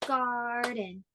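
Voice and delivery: "Garden" in two long beats; syllable-by-syllable "Garden"; split into syllables. Shown as a demonstration. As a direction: clap out "Garden" as gar, den. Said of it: "divide words into syllables".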